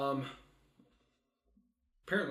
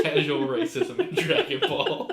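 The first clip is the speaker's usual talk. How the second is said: loud, higher, words breaking into laughter; laughing; 6 semitones higher, 10 dB louder than usual. Real, not acted. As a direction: crack up while commenting. Cracking up at the retold joke.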